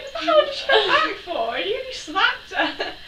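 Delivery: high, loud indignant mum, slowing at the end; mock outraged mum. Mum scolding after the guests leave, mock outraged. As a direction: ham up mum's outrage. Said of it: "my mum was like".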